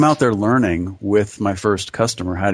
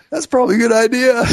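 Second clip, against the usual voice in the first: higher, louder, rushed sheepish answer; sheepish reply; 12 semitones higher, 5 dB louder, faster than usual. Retold or imitated. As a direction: blurt it out high and quick, embarrassed. Sheepish reply to calm customer.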